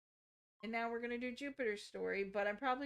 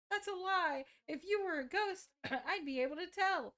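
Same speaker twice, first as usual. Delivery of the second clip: high, louder disbelieving protest; upset denial; 7 semitones higher, 4 dB louder than usual. Acted. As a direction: refuse to believe it, voice pitched up. Acted denial of a ghost's confession.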